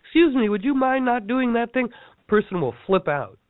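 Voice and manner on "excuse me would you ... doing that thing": high, sugary fake-polite ask; mock polite. Mocking a too-polite person asking someone to stop, high and sugary. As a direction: sweetly ask them to stop, overly nice.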